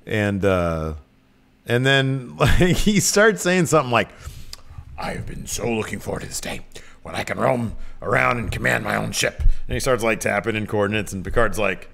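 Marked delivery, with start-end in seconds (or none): 5.0-9.4 soft, breath-heavy put-on old man; hammed-up whispery relish of an old man; old admiral. Admiral at the helm at last, savouring command.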